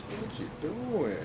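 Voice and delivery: mom voice. Retold or imitated. Mimicking a mom calling out.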